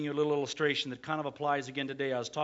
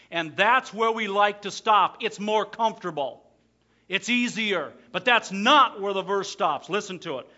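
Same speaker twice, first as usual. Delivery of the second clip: louder, rising sermon voice; full-force preaching; 5 semitones higher, 9 dB louder than usual. Acted. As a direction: press the point home.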